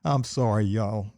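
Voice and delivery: ordinary delivery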